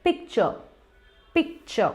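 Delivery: पिक्चर with silent final R; light falling end on पिक्चर; gentle correct pronunciation. Shown as a demonstration. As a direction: say पिक्चर as "pik-chuh". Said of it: "बहुत ही gentle तरीके से कहना है".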